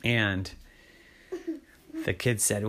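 usual speaking voice

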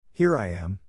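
ordinary delivery, nothing marked

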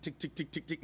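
quiet, rapid mechanical ticks; small clicking engine imitation; imitated failed-ignition ticking. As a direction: tick evenly like a dead starter. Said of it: "It goes tick".

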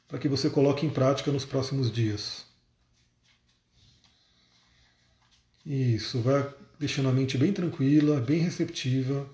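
guided meditation. Acted. Leads a short meditation.

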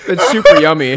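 loud laugh-cry through the burn; strained, loud and tearful with laughter; pained laughing. Real, not acted. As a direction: praise it while half crying, half laughing. Praises sauce while in pain.

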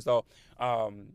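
ordinary talk

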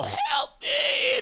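high shrieking cry for help; panicked scream. Retold or imitated. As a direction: yell it in panic. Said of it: "the guy from E R that was all".

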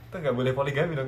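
usual speaking voice

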